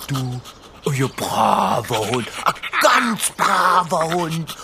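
put-on slow silly baby talk; talking to a dog. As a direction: coo at the little dog. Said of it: "so albern".

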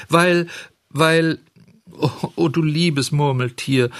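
high, halting lament; distraught stammering. A narrator's reading. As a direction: falter on each word, high and plaintive. Puppet breaks down before confessing. (klagend stockend, mit hoher Stimme)

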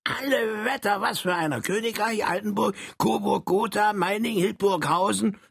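high, eager little Saxon man. Acted. Saxon character boasts to Frank, high and eager. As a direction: squeak it out like a proud little Saxon.

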